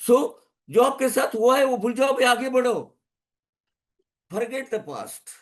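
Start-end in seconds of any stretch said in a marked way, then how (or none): 1.3-5.3 high-pitched, falling urging; raised exhortation. Real, not acted. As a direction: start high and let it fall. Exhortation, first of two takes.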